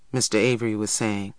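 plain reading voice

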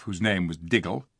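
plain reading voice

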